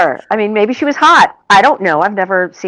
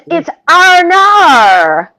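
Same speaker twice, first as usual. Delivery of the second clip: put-on higher, louder, drawn-out Aussie accent; 7 semitones higher, 5 dB louder, much slower than usual. Co-host correcting an Australian's "oh no", exaggerated Aussie accent. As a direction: stretch "Arnar" out in a big put-on Aussie accent. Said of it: "he's doing an accent".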